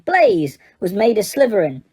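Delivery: ordinary delivery, nothing marked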